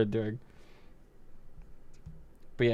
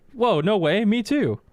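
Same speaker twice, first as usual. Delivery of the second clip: higher, fake thrilled reply; mock excitement; 10 semitones higher, 4 dB louder, slower than usual. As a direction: play along with fake glee to tease him. Mocking the kid back.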